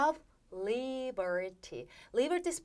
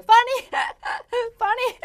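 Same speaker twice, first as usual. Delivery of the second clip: high, very loud 'funny' with put-on laughs; laughing demonstration; 10 semitones higher, 9 dB louder, much slower than usual. Shown as a demonstration. Teacher showing students funny together, loud and laughing. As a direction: act out finding it funny. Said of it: "여러분 같이 하셔야 돼요".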